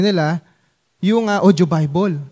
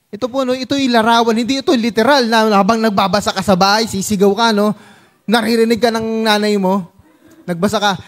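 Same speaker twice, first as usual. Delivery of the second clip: ordinary talk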